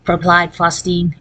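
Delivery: plain reading voice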